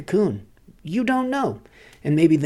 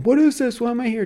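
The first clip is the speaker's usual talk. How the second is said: put-on bewildered child; 10 semitones higher, 4 dB louder, faster than usual. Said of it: "start looking around and going".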